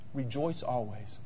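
soft, low Rejoice always; hushed quote. Acted. Pastor quotes Paul to persecuted Thessalonians, softly. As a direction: let the words Rejoice always fall quietly. Quiet quoting after persecution.

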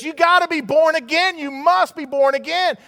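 loud, high-pitched preaching cry; emphatic command. Acted. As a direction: proclaim it with urgent force. Jesus's command, voiced by the preacher.

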